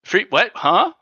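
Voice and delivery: surprised. Real, not acted. Co-host perks up at freebie.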